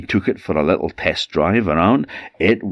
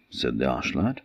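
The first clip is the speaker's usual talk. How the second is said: ordinary delivery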